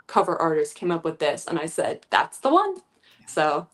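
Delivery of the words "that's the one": high, loud declaration; excited quote. Retold or imitated. Retelling her own decisive quote.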